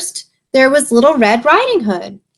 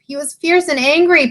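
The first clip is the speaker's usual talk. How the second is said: high, piping little voice; piping pigs; 7 semitones higher than usual. A narrator's reading. Pigs telling Little Bear about the wolf, high pipe. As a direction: pipe it up like a small pig. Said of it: "piped the pigs".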